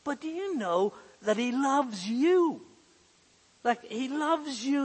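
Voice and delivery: ordinary delivery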